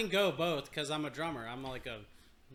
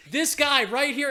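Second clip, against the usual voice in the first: loud, slow, laughing and higher; boss laughing loudly; 9 semitones higher, 10 dB louder, slower than usual. Retold or imitated. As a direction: point him out loudly while laughing. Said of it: "He was like".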